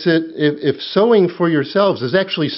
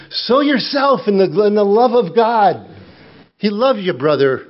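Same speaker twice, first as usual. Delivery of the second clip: raised, high-pitched preaching push; fervent preaching; 6 semitones higher than usual. Acted. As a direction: preach it out higher, pressing the call. Preaching at full force.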